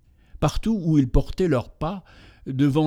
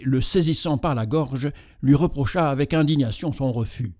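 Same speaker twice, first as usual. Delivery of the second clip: ordinary delivery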